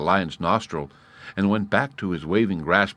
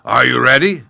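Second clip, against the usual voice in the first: much louder lion call; 6 semitones higher, 11 dB louder than usual. A narrator's reading. Lion calling to the gnat before the fight, loud. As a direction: call out the challenge.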